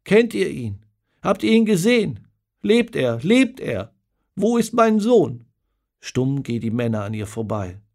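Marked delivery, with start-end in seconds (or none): 0.0-5.5 high and loud, more anguished with each question; desperate calling out. Acted. Old mother begs the soldiers for news of her son, calling out desperately. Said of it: "ruft sie zu den Männern".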